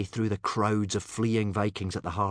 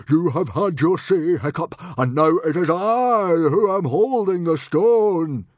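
loud, raised, booming angry father; roaring; 9 semitones higher, 11 dB louder than usual. A narrator's reading. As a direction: roar down your son with authority. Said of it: "roared Stoic".

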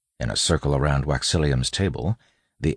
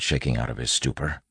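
usual speaking voice

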